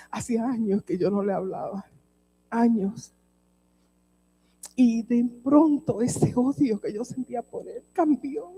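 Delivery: ordinary talk, nothing marked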